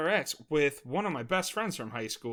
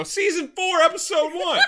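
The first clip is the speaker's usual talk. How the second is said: higher and louder, announcing like a know-it-all; mock annoying stranger; 14 semitones higher, 7 dB louder than usual. Stall guy announcing the episode through the door, hammed-up. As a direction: play the smug guy calling out the episode. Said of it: "type of guy who'll open the door and go".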